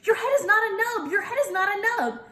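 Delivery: dance around him with delight; child bursting with excitement; very thrilled. Acted. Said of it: "I danced all around that guy, very thrilled".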